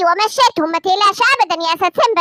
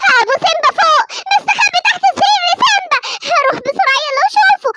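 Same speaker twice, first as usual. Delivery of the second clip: mother fibbing; 8 semitones higher than usual. Acted. Mother tells false hiding place.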